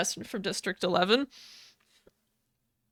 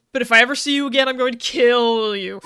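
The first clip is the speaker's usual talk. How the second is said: louder mock threat, stretching the end; retold threat; 7 dB louder than usual. Recapper voicing the District Eleven boy sparing Katniss, mock threat. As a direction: build to a hammed-up menacing warning.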